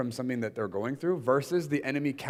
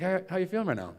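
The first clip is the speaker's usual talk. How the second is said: light bright asking voice; 8 semitones higher, faster than usual. Retold or imitated. Speaker retells asking intern.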